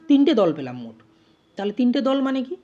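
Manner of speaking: ordinary delivery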